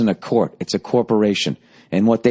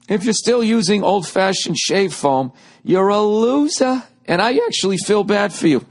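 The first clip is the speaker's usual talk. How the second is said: upbeat, higher-pitched ad read; 10 semitones higher than usual. Acted. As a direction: sell it like a radio spot. Podcast ad read.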